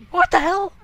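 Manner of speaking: high-pitched loud disbelief; shocked outburst. Real, not acted. Player startled by the game, shocked high shout.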